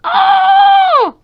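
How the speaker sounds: sudden high shriek of fright; pretend scream. [name] screaming from off near the bathroom, loud shriek. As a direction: scream like something just grabbed you. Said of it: "I had a scream!".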